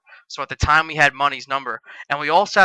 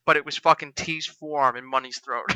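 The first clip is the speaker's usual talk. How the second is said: ordinary talk, nothing marked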